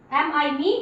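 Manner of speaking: usual speaking voice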